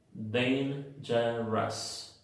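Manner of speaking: "Dangerous" very slowly, ending in a relaxed "us"; slow model of "Dangerous" with a schwa in the last syllable; schwa plus S. Shown as a demonstration. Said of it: "se pronuncian con una schwa y una S".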